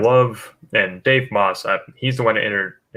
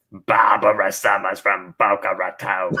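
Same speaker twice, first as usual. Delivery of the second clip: slow, put-on old lady proclamation; Barbara voice; slower than usual. Viewer's line voiced as Barbara, slow and grand. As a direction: announce her grandly. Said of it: "Michael Schaefer says".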